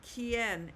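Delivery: "quien" as kyen, joined up, U silent; Spanish pronunciation. Shown as a demonstration. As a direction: say "quien" smoothly with a K start. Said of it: "is how we pronounce the word".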